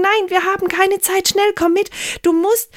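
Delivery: high, insistent pleading; no time, pull her along; urgent girl. Acted. Mirjam insists her mother come now, high and pressing.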